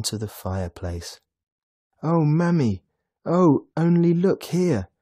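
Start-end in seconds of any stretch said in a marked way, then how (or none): 2.0-4.9 put-on high, loud, thrilled child; little boy overjoyed at his presents; amazed gasp. A narrator's reading. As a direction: exclaim in delighted wonder. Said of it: "the boy gasped".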